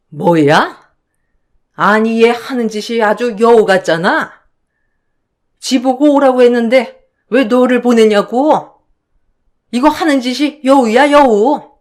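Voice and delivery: put-on contemptuous older woman calling her a fox; scornful jab, lightly voiced; sneering mother-in-law. Mother-in-law mocking her daughter-in-law to her son, scornful.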